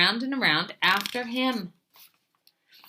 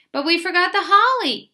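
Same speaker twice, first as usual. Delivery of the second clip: high, louder, excited little cry; cried out; 10 semitones higher, 6 dB louder than usual. A narrator's reading. Little Mouse to Big Mouse about the holly, crying out. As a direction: exclaim it like a small worried child. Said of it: "cried Little Mouse".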